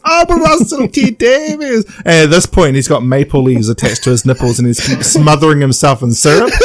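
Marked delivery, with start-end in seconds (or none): 0.0-1.9 a hammed-up swoon over his own name; high mock-dreamy self-adoration; mock Russell voice. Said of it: "Russell appears on the screen again and goes".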